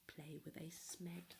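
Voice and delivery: hushed, secretive whisper; whispered warning. Acted.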